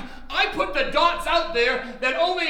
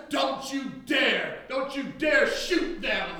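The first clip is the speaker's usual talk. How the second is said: slow, heavy stress on dare; slow stern warning; much slower than usual. Acted. Preacher warning his congregation, slow and stern. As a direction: lean on every dare.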